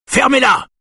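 high, loud bark; barked order. Acted. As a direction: snap it like an angry cop. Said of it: "aboie quelqu'un". (aboiement aigu et fort)

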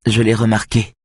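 ordinary delivery

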